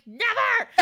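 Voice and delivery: very high, theatrical defiant cry; hammed-up squeal of defiance; defiant refusal. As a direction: fling it back in mock heroics. Heroine refuses her captor.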